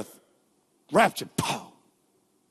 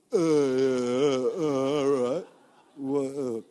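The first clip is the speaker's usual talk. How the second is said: slow, lower hesitant mumbling; awkward stalling; 6 semitones lower, much slower than usual. Pretend. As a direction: play a flustered listener stuck for words. Congregant staring back, awkward reply.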